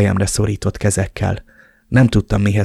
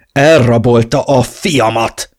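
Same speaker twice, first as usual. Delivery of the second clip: furious outburst; 2 semitones higher, 6 dB louder, slower than usual. Acted. Furious father.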